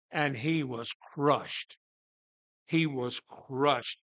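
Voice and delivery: slow, low and hushed; solemn sorrow. Real, not acted. Preacher tells of Peter crushed after denying Jesus, hushed and sorrowful.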